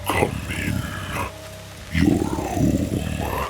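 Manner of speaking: low unvoiced demon whisper; demonic whisper. A narrator's reading. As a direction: breathe it like a demon in the dark. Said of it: "called the demoniac voice from the shadows".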